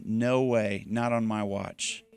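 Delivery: a hushed, certain refusal; calm protective God. Acted. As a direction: settle it softly.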